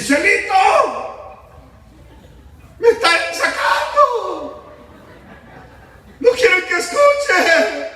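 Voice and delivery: very high, drawn-out wail; hammed-up desperate drunk's yell; anguished cry for help. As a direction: wail as you are dragged away. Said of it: "Aquel hombre con angustia".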